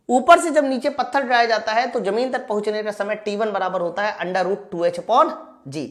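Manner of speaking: normal talking voice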